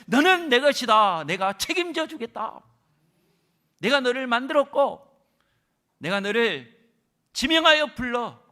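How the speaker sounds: loud, high, slow proclamation; God's loud promise. Acted. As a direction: call it out with full force. (크게 외치는 하나님의 약속)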